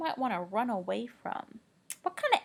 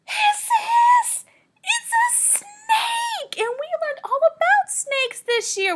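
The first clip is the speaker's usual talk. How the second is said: excited discovery; 10 dB louder than usual. Pretend. Teacher acts thrilled finding the snake for kids. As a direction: burst out like a big surprise.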